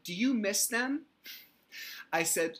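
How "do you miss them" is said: much higher, louder fake-sweet question; sugary sarcasm aimed at the ex; mock sympathy.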